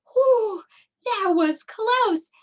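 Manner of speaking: high, drawn-out mock relief; pretend relief. Teacher after a close call with the bear, exaggerated relief. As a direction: play up a big sigh of relief.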